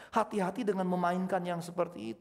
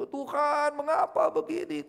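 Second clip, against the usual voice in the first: high, loud anguished cry to God; 11 semitones higher, 6 dB louder, slower than usual. Retold or imitated. Alone in the car, crying out to God in anguish. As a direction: wail it out, much higher and louder. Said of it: "saya bisa jerit gitu".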